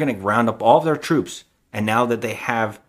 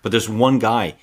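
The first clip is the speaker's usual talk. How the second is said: ordinary talk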